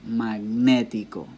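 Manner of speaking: Magnético said slowly with a long, stressed "né"; slow demo of Magnético, weight on the second syllable. Shown as a demonstration. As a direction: lean hard on the "né" in Magnético. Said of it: "It's on ne".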